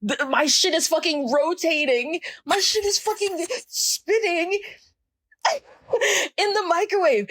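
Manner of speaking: very high, incredulous squeal, breaking into laughter; exasperated outburst. Retold or imitated. Exasperated rant about spinning food.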